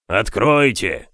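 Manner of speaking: slow, loud sepulchral growl; growling grave voice. A narrator's reading. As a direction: growl it like something dead. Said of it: "рычал могильный голос". (могильный рык)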